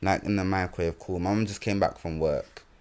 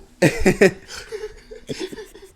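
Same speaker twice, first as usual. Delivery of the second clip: high burst of real laughter; sudden high laugh at the joke; 4 dB louder than usual. Real, not acted.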